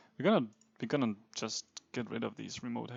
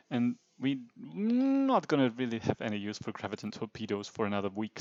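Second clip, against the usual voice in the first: ordinary delivery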